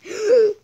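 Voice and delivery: loud startled gasp; sudden loud gasp; sharp gasp. Real, not acted. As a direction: gasp in shock. Sudden game event.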